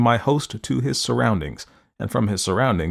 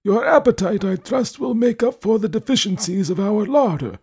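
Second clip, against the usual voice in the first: put-on high, formal old man voice; stiffly polite elderly host; courteous old man; 11 semitones higher than usual. A narrator's reading.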